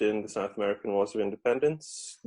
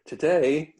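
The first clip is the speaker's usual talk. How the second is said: lightly put-on children's TV presenter, raised and louder; CBBC presenter voice; 5 semitones higher, 6 dB louder than usual. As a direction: announce it like a mock kids' TV host. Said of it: "we say in our best C B B C, uh, presenter voice".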